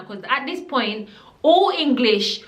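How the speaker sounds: normal talking voice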